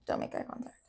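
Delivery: very soft whisper; voiceless, trailing murmur; hushed echo. Real, not acted. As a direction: whisper it almost to yourself.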